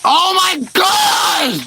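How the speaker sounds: high, drawn-out scream of real frustration; frustrated scream. Real, not acted. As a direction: scream at the game in real rage. Raging at Minecraft Nether game.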